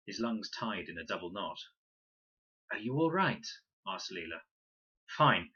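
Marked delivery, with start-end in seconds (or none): 2.7-3.5 Leela asks. A narrator's reading. Leela worried about Fred. 5.2-5.5 short, sharp and loud; brisk. A narrator's reading. Fred brushing off Leela's concern, brisk and loud. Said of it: "more briskly than he'd intended".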